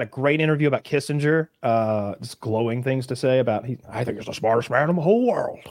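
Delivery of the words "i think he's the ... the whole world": lightly put-on statesman's voice; Nixon impression. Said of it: "That was pretty good".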